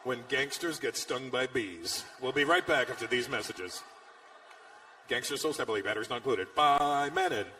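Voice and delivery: send-up of an ad voice-over; mock TV announcer. Parody TV promo and toy ad.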